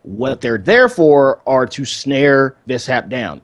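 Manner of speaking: normal talking voice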